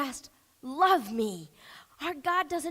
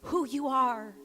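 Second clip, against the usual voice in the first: soft, earnest prayer over music; quiet altar prayer; pastor's prayer; 2 semitones higher, 7 dB quieter than usual. Acted. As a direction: pray gently for the hurting. Leads closing prayer over music.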